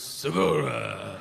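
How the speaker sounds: slow mock Japanese accent, drawn out; Japanese-style. Hotel clerk repeats guest's surname, mock Japanese accent. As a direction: stretch the name into a mock Japanese pronunciation. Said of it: "you're saying it Japanese".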